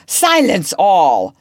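loud, slow gloating command; captain gloating loudly for silence on deck; gloating call. A narrator's reading. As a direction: relish the order like a triumph. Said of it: "he called gloatingly".

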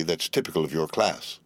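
usual speaking voice

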